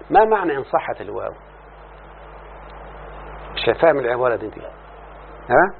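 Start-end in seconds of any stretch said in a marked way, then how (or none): none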